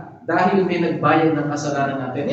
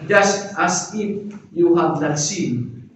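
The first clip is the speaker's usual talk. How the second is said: "Just as if you have not sinned" slow, each word laid out; preacher slowly unpacks what justification means, deliberate and spaced; spelled-out meaning; much slower than usual. Shown as a demonstration. Preacher defines justification to the congregation, slow and deliberate. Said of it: "Alam niyo yung justification".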